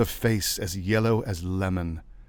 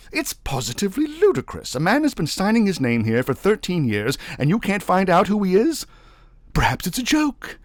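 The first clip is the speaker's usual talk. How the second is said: loud, high, exasperated official; official Navagin, irritated; 12 semitones higher, 8 dB louder than usual. A narrator's reading. Navagin questioning his porter, irritated. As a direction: press the porter for answers.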